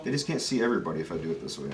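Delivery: usual speaking voice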